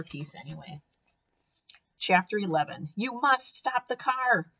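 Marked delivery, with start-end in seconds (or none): 3.0-4.5 high, slow emphatic call; shouted order. A narrator's reading. Officer ordering Mister Watson to stop, shouted. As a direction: call it out urgently, word by word. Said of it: "shouted Officer Tomilello".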